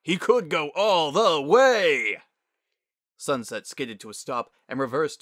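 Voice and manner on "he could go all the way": booming, slow mock commentary shout; loud crowing dad playing announcer; drawn-out sportscaster yell. A narrator's reading. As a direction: yell it out, stretching the words. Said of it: "he yelled".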